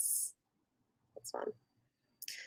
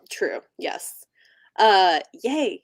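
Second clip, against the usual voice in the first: ordinary delivery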